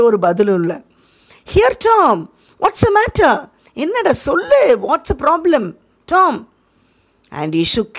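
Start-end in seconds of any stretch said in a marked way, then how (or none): 1.5-6.5 put-on high, pleading boy's voice; anxious boy. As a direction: beg Tom to say what's wrong. Sid anxiously asks Tom. (சித்துவின் பதட்டமான உயர்ந்த குரல்)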